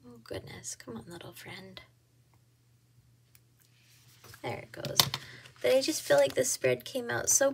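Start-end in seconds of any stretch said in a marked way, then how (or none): 0.0-5.0 very soft, half-whispered aside; hushed, absorbed self-talk; murmuring to herself. Real, not acted. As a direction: coax a fiddly little thing under your breath. Coaxing a fiddly sticker, to herself.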